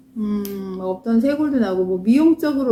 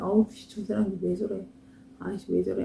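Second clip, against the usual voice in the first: muttered grumble; 7 dB quieter than usual. Retold or imitated. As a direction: grumble softly, as if about a stranger. Retells her own grumbling at hikers.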